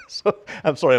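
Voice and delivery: real laugh breaking through the apology; giggling, can't hold it in; sorry-I'm-laughing chuckle. Real, not acted. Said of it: "I'm laughing".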